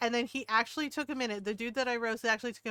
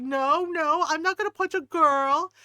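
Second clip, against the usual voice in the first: higher, much louder mock protest; 5 semitones higher, 8 dB louder than usual. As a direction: imitate a man loudly refusing. Man retold protesting he won't punch.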